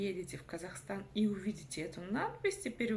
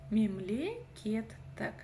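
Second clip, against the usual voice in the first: "Мемлекеттік" at half pace; slow careful recap of "Мемлекеттік"; syllable by syllable; much slower than usual. Shown as a demonstration. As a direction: separate the parts of "Мемлекеттік" slowly. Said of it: "нужно разделить по слогам".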